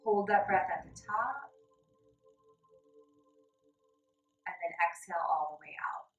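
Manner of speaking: calm, even guided breath instructions. Acted. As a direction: lead them gently through each breath. Guided breathing in yoga lesson.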